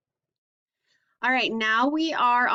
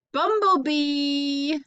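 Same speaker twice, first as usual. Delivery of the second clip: bumblebee higher, louder and very slow; drawn-out reveal; 5 semitones higher, 4 dB louder, much slower than usual. Shown as a demonstration. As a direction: build the bu- up and land on bumblebee. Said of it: "this stocking stuffer has a bu".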